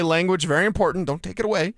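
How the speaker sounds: normal talking voice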